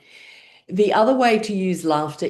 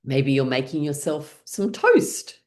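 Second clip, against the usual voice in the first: ordinary talk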